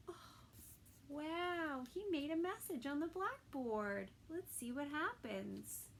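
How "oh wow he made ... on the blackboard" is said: usual speaking voice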